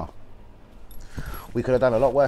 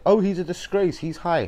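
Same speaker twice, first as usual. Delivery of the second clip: mocking the critics; 8 semitones higher than usual. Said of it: "shouts out to everyone that said".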